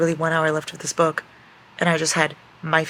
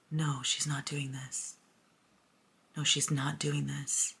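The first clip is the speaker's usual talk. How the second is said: slow, soft, pleading disbelief; dismayed denial; 8 dB quieter, slower than usual. Real, not acted. Reader pleads with the book, slow and soft dismay. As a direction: beg the story not to happen.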